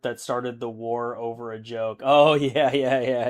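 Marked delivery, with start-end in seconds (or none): 2.0-3.3 laughing. Real, not acted. Reading a funny comment.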